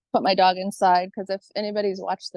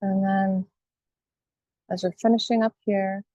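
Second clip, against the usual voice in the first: guided meditation. Acted. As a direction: guide listeners calmly, phrase by phrase, leaving space.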